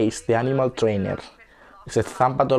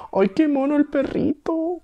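cooing over puppy; slower than usual. Real, not acted.

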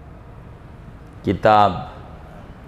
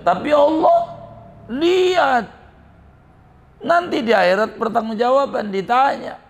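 high-pitched acted child's warning; earnest admonishing; 11 semitones higher than usual. Boy warning the robber king, earnest and high. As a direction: press the point home, voice pitched high.

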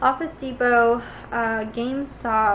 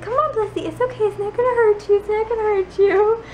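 higher sing-song coaxing, a touch mock; imitating coaxing baby talk; 9 semitones higher than usual. Family coaxing Bliss to drink from the fountain, in imitated high baby talk. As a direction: coax a scared puppy gently. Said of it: "'cause they're like".

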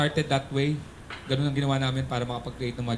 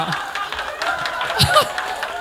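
high breathy cackle at own joke; high unvoiced laugh; 3 dB louder than usual. Real, not acted. Comedian laughing at his own joke, high silent wheeze. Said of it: "Nakakatawa kaya?".

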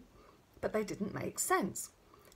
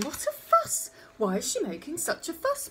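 high-pitched, raised-voice protest; exasperated surprise; 8 semitones higher, 5 dB louder than usual. A narrator's reading. Father asking why his daughter makes a fuss, exasperated. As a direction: exclaim in astonished complaint.

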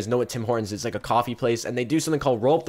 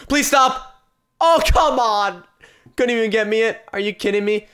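high, loud cry of disbelief; exasperated pleading; 8 semitones higher, 5 dB louder than usual. Real, not acted. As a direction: plead loudly as the luck goes against you. Frustrated reaction to bad case opening.

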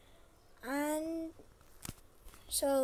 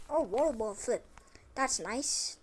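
normal talking voice

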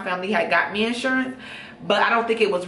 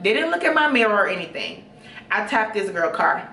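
normal talking voice

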